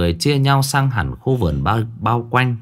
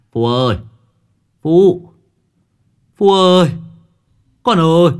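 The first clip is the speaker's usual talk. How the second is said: father yelling his son's name, much louder and higher; desperate shouting; 8 semitones higher, 7 dB louder than usual. Acted. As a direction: scream the boy's name in panic. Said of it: "Ông Quý hét lên".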